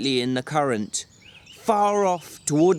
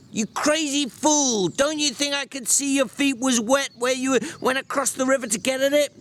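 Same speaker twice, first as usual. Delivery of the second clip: angry shouting; 11 semitones higher than usual. Acted. George yells at Lennie.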